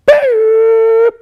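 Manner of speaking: very high, loud cartoon boop; squeaky cartoon boop; playful boop. Real, not acted. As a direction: pop it out like a sound effect. Playful sound effect.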